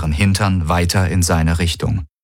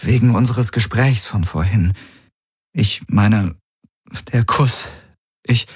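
halting, higher voice with long pauses; tentative, searching for words; hesitant; 5 semitones higher than usual. A narrator's reading. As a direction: feel your way through it, breaking off unfinished. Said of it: "zögerlicher diesmal".

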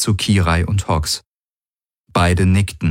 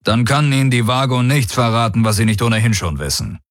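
higher-pitched, confident commander; self-assured warlord; 5 semitones higher, faster than usual. Acted. Daraios answering the alien commander, self-assured and higher. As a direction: play the unruffled field commander.

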